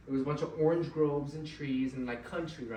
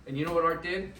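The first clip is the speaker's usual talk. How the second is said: usual speaking voice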